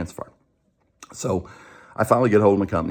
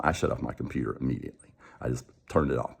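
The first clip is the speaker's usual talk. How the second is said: usual speaking voice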